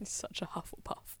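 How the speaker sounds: a hushed, mischievous jab; teasing whisper. Real, not acted. Co-host teases her friend about her house, whispering. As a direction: whisper "You're such a Hufflepuff" as a tease.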